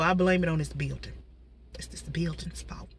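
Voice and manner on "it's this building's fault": conspiratorial whisper. Real, not acted. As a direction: whisper the blame. Whispered blame aside.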